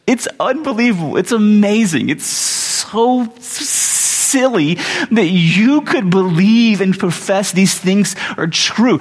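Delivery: high, drawn-out amazement; incredulous. Real, not acted. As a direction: stretch the words in exasperated disbelief, pitched high. Preacher marvels at Jonah's silliness.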